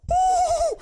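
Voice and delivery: high loud whoop; excited whoop. Real, not acted. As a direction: let out a big excited woo. Excited outburst mid-story.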